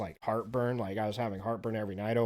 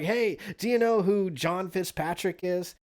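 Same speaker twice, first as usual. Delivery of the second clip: slow, higher mock question; guy at the wedding; 7 semitones higher than usual. Wedding guest asks the speaker, slow higher mock voice. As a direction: lean in and ask slowly. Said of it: "is like".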